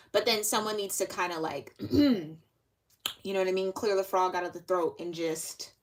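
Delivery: normal talking voice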